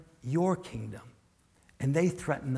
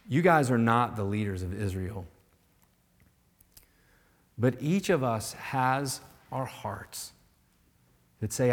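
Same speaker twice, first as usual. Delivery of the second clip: ordinary talk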